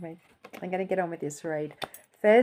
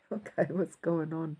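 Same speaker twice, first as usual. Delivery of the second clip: ordinary talk, nothing marked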